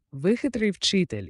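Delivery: impressed and delighted; admiring. A narrator's reading. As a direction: exclaim it with open admiration. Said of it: "із захопленням мовив".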